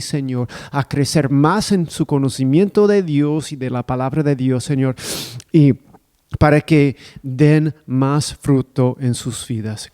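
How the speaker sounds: normal talking voice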